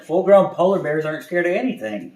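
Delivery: loud, high triumphant; triumphant climax. A narrator's reading. Storyteller to listener at the end, loud and proud. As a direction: proclaim it proudly.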